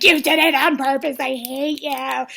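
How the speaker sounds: loud, hammed-up outburst, last words dragged; mock furious accusation. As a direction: yell it like a reality-show feud. Imitates contestant's angry accusation.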